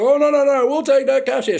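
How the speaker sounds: high, loud flustered backpedalling; mocking the worker who suddenly takes cash; panicky servo worker. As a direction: squeak out a panicked reversal.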